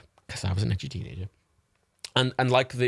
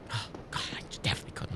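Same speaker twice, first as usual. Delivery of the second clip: unvoiced whisper, far softer; whispered aside; 12 dB quieter, much faster than usual. Real, not acted. Gamer denying being an edgy teen, whispered. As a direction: breathe it as a secret aside.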